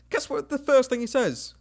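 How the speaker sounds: high, loud rhetorical question; triumphant build-up. Real, not acted. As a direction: pitch it way up, teasing the reveal. Gleeful build-up to the punchline.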